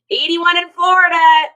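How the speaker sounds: high and loud, reading out the warm weather; loud surprised readout; excited shout. Real, not acted. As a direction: call it out like it's amazing. Reads viewer's warm weather.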